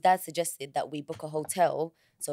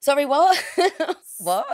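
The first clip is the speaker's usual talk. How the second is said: loud, high startled "what", laugh mixed in; real surprise; 10 semitones higher, 9 dB louder than usual. Real, not acted. Reacting to friend's gasp, loudly startled. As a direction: jolt up in disbelief.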